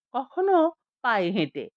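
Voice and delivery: ordinary delivery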